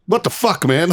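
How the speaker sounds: high, incredulous retold outburst; baffled exclamation. Marine retelling his reaction to military jargon, baffled.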